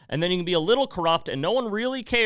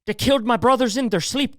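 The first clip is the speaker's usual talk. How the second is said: put-on Irish accent repeated; 9 semitones higher, 5 dB louder than usual. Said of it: "Can't do a very good Irish accent".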